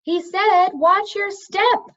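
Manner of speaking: high, loud, slow shout; top of her lungs. A narrator's reading. Cimmerian repeating the warning to Telemain over the roar, slow loud shout. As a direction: bellow it slowly so he hears over the falls. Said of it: "at the top of her lungs".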